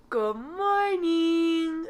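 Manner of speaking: high, slow drawn-out good morning; sing-song greeting. Real, not acted. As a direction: stretch it out brightly. Early morning greeting to camera.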